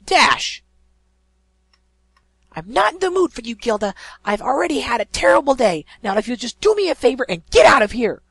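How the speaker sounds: loud, high, furious snapping; spat angrily. Acted. Pegasus snapping at the griffin while leaving, furious. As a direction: spit the words over your shoulder. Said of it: "Rainbow spat over her shoulder".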